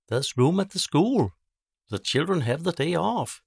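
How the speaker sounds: teacher Mary offering the school to the villagers; eager teacher. Acted. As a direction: call out the good news. Teacher announcing a rescue.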